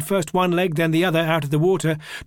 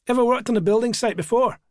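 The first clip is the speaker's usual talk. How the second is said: higher-pitched, lightly Glaswegian question; Glaswegian traveller; 6 semitones higher than usual. A narrator's reading. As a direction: sound out a newcomer in a light Glasgow accent. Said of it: "a traveller from Glasgow".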